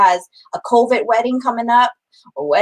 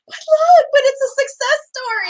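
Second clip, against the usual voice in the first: much higher, laughing, half-pleading; retold eager pleading; 14 semitones higher than usual. Wife retells pleading with husband to watch, laughing and eager. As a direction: sell it to him with a giggle. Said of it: "I said".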